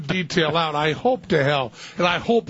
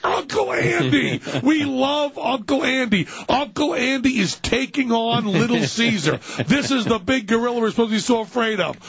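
gleeful hype; 5 semitones higher than usual. Real, not acted. Radio host savors caller's uncle taking on Little Caesar, gleeful hype. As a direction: hype it like a big showdown. Host relishes and hypes the story.

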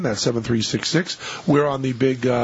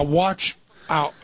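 drawn-out warning with a gap between words; said slowly. Retold or imitated. Friend distracting passersby before the curb, slow warning. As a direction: imitate a deliberately slow warning. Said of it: "He would say it slowly".

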